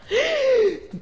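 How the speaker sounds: sudden high startled gasp; sharp gasp. Real, not acted. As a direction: gasp in shock. Sees sudden violence in game.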